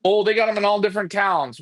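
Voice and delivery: ordinary delivery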